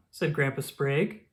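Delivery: ordinary delivery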